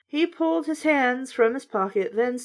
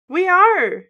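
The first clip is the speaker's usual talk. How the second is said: higher, louder insistence; insistent; 8 semitones higher, 5 dB louder than usual. A narrator's reading. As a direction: press the point, pitched up. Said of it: "Jed insisted".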